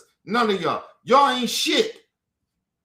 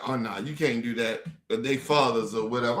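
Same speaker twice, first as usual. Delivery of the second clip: much lower and softer mock of his sister; 10 semitones lower, 6 dB quieter than usual. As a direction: sneer her refusal back in a low voice. Mocking his sister's refusal.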